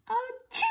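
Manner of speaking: a big high sneeze. A narrator's reading. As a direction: burst out a sudden sneeze. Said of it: "A sneeze could not be held back".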